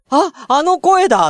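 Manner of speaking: imitating fans spotting him; excited recognition. People recognizing his voice. (声に気づいて驚く高い声)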